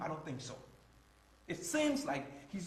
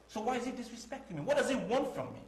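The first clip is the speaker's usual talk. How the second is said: higher, rising indignation; indignant protest; 7 semitones higher, 5 dB louder than usual. Acted. Boyfriend protests to his girlfriend about her father, indignant. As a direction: demand an answer, hurt and louder.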